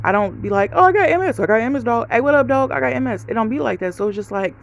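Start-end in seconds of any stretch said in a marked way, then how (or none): 0.7-3.2 loud, high, hammed-up casual boast; mock bragging. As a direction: parody announcing it to every buddy you meet. Mimics someone flaunting their illness.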